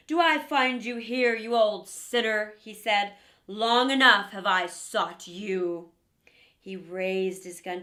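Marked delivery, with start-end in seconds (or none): 0.1-5.9 loud, low triumphant challenge; stern huntsman. Acted. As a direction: confront the beast boldly. Huntsman confronts wolf.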